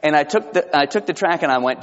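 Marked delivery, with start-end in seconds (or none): none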